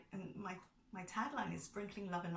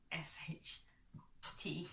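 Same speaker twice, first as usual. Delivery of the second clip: spelled-out whisper; 5 dB quieter, slower than usual. Real, not acted. To viewers, whispering the swear word letter by letter. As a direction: whisper the letters, hushed and discreet.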